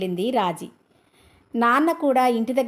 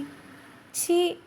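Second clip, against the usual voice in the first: a high, soft, bashful "ఛీ"; coy embarrassed gasp of a word; lightly voiced shy young wife; shy bride; 6 semitones higher, 5 dB quieter than usual. A narrator's reading. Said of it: "ఆగి ఆగి అవస్థలు పడుతూ".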